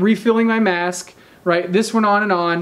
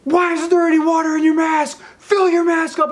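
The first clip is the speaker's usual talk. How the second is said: drill instructor yelling; 9 semitones higher than usual. Retold or imitated. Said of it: "they're screaming at me".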